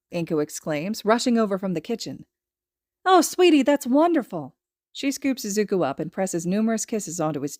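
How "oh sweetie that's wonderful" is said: high, loud delight; delighted mother. Acted. Mother delighted at her son's news, high and gushing. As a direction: gush over your child. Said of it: "mother delights".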